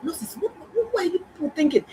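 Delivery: high, incredulous outburst at the nurses; exasperated outcry. Retold or imitated. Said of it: "I was like".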